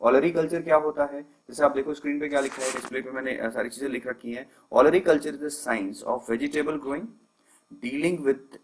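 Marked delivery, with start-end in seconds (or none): 4.7-8.6 each word slow and separate, for notes; slow dictation. Shown as a demonstration. Teacher slowly dictating the definition to students. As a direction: spell out the definition slowly so students can write. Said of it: "teacher dictating the definition".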